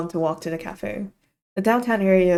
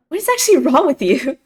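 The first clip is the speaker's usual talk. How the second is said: high, loud mock outrage; amused exasperation; 8 semitones higher, 8 dB louder than usual. Friend scolding Iggy after the waiter prank, exasperated.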